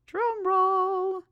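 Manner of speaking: high, drawn-out drum roll call; playful announcer. Pretend. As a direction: announce it on a high held note. Acts out the requested drum roll.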